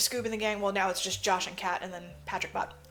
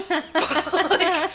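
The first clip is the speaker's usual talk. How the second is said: laughing; 9 semitones higher, 7 dB louder than usual. Real, not acted. Laughs at own joke.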